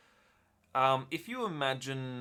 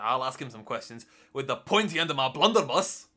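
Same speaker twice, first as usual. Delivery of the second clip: loud, higher-pitched angry dwarf threat; dwarf shouting; 3 semitones higher, 10 dB louder than usual. Acted. Dwarf outside a locked door threatening the elf, shouting. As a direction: bellow it as the blustering dwarf, building to the end. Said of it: "banging on it and shouting".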